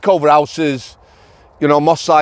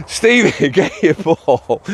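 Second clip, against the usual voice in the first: slow, words squeezed out between laughs; trying to talk while cracking up; laughing through words; 3 semitones higher, much slower than usual. Real, not acted. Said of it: "I'm sorry if I'm laughing".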